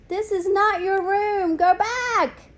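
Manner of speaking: high, loud little squeak; squeaky hamster. A narrator's reading. Hamster tells the students to go back, squeaking high. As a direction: squeak firmly, small but insistent. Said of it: "I squeaked".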